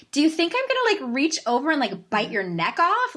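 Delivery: high start, easing down as it goes; mock incredulous comeback. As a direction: play up exasperation at an over-careful question. Retold exasperated comeback to cautious friends.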